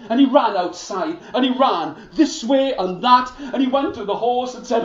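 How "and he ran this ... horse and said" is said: ordinary delivery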